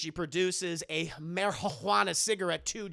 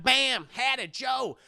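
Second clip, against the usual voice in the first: loud, higher mock summons; calling out names; 5 semitones higher, 5 dB louder, slower than usual. Madea summons Bam, Hattie and Joe, calling out. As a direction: holler for everyone in the retelling.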